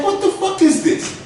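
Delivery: can't believe what he's seeing; high incredulous yell. Retold or imitated. To friend Jose about the drugs, a high stunned demand.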